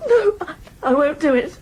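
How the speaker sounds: sobbing, stammering no; crying refusal. Acted. Tearful refusal to her uncle, sobbing. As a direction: refuse through the tears.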